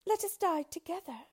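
high-pitched princess voice; high, earnest appeal; pleading princess. A narrator's reading. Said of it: "continued she".

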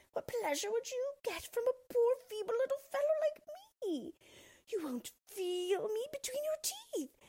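very high, squealing plea for life; frantic little dwarf pleading in terror; terrified dwarf. A narrator's reading. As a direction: beg in a panicked, high dwarf voice. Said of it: "Then he cried in terror".

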